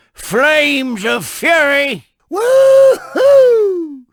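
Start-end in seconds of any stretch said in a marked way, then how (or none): 2.3-4.0 high, loud whoop of triumph; loud woo-hoo. Acted. Daredevil tow truck jumping through fire, loud whoop. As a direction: let out a big daredevil whoop. Flames of Fury stunt.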